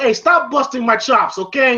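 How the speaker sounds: lightly voiced irritated Paulie; mock Paulie. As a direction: play the annoyed Paulie brushing off the robot. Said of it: "Paulie's looking at the robot".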